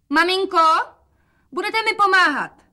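very high, sing-out call. A narrator's reading. Daughter asking mother for help, calling high. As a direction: call it high for others to hear. Daughter calls aloud before whispering.

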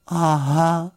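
small, high, sheepish voice; mildly downcast little bear; crestfallen. A narrator's reading. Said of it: "řekl Paddington schlíple".

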